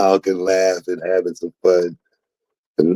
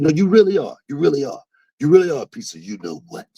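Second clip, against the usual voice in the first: retold heated insult; 9 semitones higher, faster than usual. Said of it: "I said".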